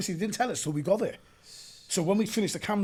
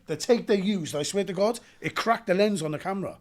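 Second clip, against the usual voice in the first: normal talking voice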